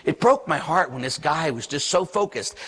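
ordinary delivery

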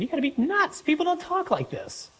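very high, incredulous protest; scandalized disbelief. Acted. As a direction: squeak upward in comic disbelief. Acts out the scandalized reaction.

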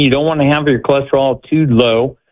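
ordinary talk

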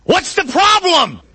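high, drawn-out holler over the wind; yelling back. Acted. Jesus yelling back to the boat, loud high yell. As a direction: shout it slowly across a storm. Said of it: "he has to yell back".

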